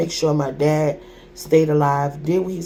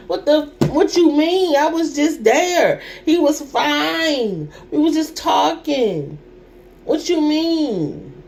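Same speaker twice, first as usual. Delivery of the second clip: pitched up high, slow and pleading; high, disbelieving; 8 semitones higher than usual. Real, not acted. Daughter refuses her father's death, high anguished disbelief. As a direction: cry it out, voice raised high in anguish.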